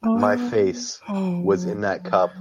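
slow, high, drawn-out telling; slow it down for the gross part; dramatic storyteller voice. Acted. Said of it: "best storyteller voice".

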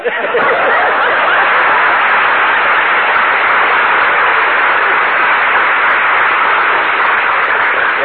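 big laugh. Real, not acted. Reacts to stomped packages.

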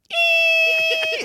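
very high shrill squeal; mock female squeal. Shoresy mimicking the mom in his taunt, piercing squeal. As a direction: squeal like a woman in bed. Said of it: "she was like".

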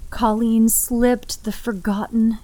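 plain reading voice

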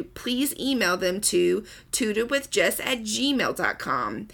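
ordinary talk, nothing marked

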